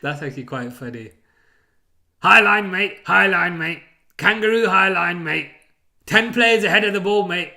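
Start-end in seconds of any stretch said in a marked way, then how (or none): 2.2-7.5 loud Aussie coach bark; loud mocking Aussie manager chant. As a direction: shout it like a cocky Aussie manager. Mocking Ange's catchphrase.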